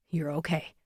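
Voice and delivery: soft, low repeated reassurance; low and gentle. Acted. Firefighter repeating comfort to the boy, soft gentle. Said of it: "His voice is low now, gentle".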